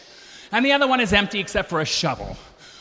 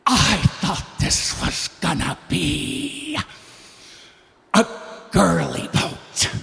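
slow, lower mock voice of her; quoting her; 3 semitones lower, much slower than usual. Retelling what she said, in a slow mock voice. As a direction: drawl it out like a disappointed lady. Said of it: "and she says".

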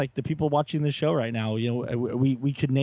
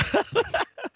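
short high burst of laughter. Real, not acted. Laughing after teasing.

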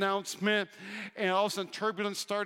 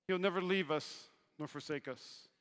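ordinary delivery

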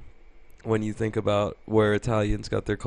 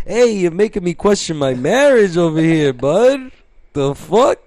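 loud, raised mock-Italian indignation; yelling Italian guy; 6 semitones higher, 5 dB louder than usual. Italian guy confronting another driver, hammed-up accented outrage. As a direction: protest like an Italian driver with road rage. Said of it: "while he's yelling at people".

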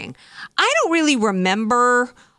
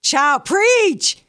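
mock church amen; 6 semitones higher, much slower than usual. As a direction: ham up a churchgoer egging on the preacher. Mocking a church crowd.

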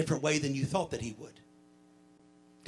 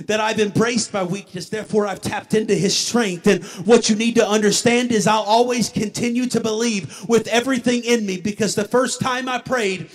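driving sermon delivery, louder and higher than the opening; impassioned preaching; 4 semitones higher, 6 dB louder than usual. Acted. Preacher testifying to the church about strength to stand, impassioned. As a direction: preach it in an unbroken, building run.

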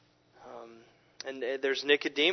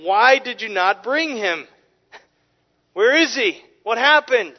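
a hint of indignant rulers, a bit higher and louder; demanding rulers; 7 semitones higher, 7 dB louder than usual. Sanhedrin rulers grilling their officers, mildly indignant demand. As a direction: lightly voice the rulers demanding answers. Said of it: "and they said to them".

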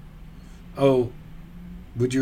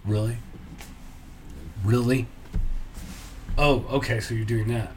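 verbal eye roll; 3 semitones lower, 5 dB quieter than usual. Retold or imitated. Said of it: "I'll do a verbal eye roll".

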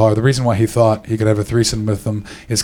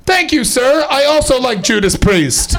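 high, prim, overly polite thanks; mock polite reply; 12 semitones higher, slower than usual. Thanking a heckler from a car, high prim voice. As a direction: answer like a proper gentleman.

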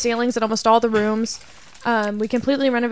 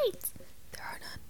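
hushed whisper; 25 dB quieter, faster than usual. Pretend. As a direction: whisper it secretly. Whispered joke.

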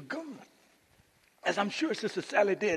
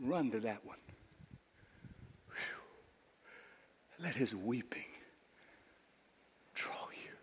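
low, very soft, spaced out; hushed appeal; 8 semitones lower, 13 dB quieter, slower than usual. Acted. Preacher inviting church to run to Jesus, hushed appeal. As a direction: sink to a near-whisper.

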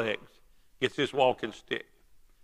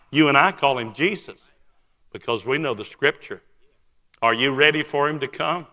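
ordinary talk

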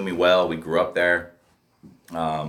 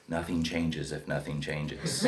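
mock counselor; 3 semitones lower, 9 dB quieter, slower than usual. Mocking counselor's slogan.

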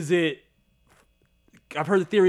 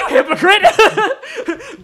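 laughing mock accusation; 9 dB louder, much slower than usual. Mocking Wanda as hypocrite.

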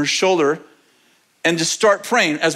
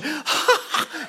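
high, gasping mock laugh; imitated hysterical laugh; 9 semitones higher, 5 dB quieter than usual. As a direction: mimic helpless, joy-struck laughter. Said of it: "she's laughing in the way that makes other people laugh".

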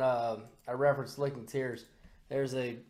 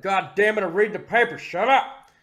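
loud, higher irritated father; mock angry dad; 5 semitones higher, 8 dB louder than usual. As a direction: yell it like a dad interrupted at breakfast. Dad snaps at child, retold.

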